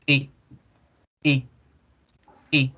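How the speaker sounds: very short "I", said seriously; "I" short, with a neutral unsmiling mouth. Shown as a demonstration. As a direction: clip the "I" as if annoyed. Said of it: "la que uno produce con seriedad".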